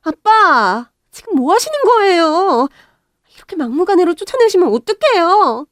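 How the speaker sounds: high, loud indignant yell at dad; shouting at father. Acted. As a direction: cry out in protest, stopping her father. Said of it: "건물 사장한테 소리를 지르더라고요".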